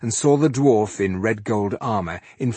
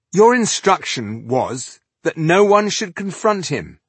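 loud, higher-pitched objection; protesting excuse; 9 semitones higher, 6 dB louder than usual. A narrator's reading. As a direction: argue back in her defense. Protesting to an angry leader.

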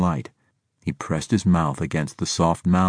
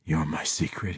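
very soft, understated gasp; hushed, lightly acted gasp; 3 semitones lower, 9 dB quieter than usual. A narrator's reading. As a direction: let it out softly, as if gasping. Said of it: "he gasped".

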